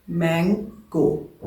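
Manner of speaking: Mango very slowly, broken into मैं and गो; split into syllables. Shown as a demonstration. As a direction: say mango as two separate, drawn-out beats. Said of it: "दो दफ़ा खुला है".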